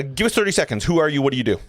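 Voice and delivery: ordinary delivery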